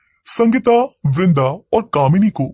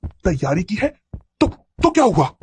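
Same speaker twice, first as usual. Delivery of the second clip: acted crazed mockery; mad girl's taunting; 3 semitones higher than usual. A narrator's reading. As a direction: taunt them as the mad girl running off. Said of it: "भागते हुए भी वो यही बात कह रही थी".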